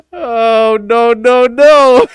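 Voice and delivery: high, loud mock dismay; imitating a viral "oh no", full out; meme imitation. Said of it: "I forgot all about that meme".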